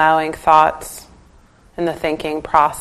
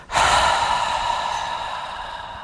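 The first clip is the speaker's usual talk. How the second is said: long, voiceless breath out; full audible exhale; 5 dB quieter than usual. Shown as a demonstration. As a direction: let all the air out in a long sigh. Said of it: "very full exhale that's audible".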